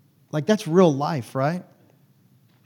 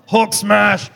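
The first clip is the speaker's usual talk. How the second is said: loud, high, booming Hulk-style battle cry; Hulk roar; 7 semitones higher, 8 dB louder than usual. Pretend. Pastor imitating a raging Ryan as the Hulk, loud roar. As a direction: smash it out like an angry green giant. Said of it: "I imagine Ryan getting mad and ripping his truck in half".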